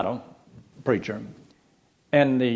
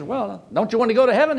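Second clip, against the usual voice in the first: puzzled preacher; 11 semitones higher, 5 dB louder than usual. Retold or imitated. Preacher questions man in back.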